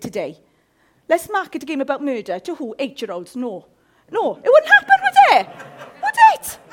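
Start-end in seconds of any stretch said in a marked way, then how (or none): 4.1-6.6 high laughing disbelief; high, loud, laughing would it. Real, not acted. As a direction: squeal it with a laugh. Laughing incredulous.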